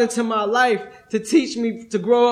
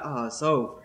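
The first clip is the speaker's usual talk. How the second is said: softer, lower, a quiet sigh of relief; relieved inner thought; 9 semitones lower, 8 dB quieter than usual. Retold or imitated. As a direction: let it drop like a weight off your shoulders. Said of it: "I thought".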